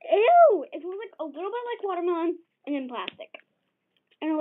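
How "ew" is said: disgusted. Real, not acted. Reacts to a plastic smell.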